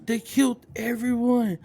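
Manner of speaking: high, loud panicked outburst; freaking out. Acted. Kiba realizes the whole party is dead, freaking out. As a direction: panic loudly, stammering as it overwhelms you. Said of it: "He's freaking out".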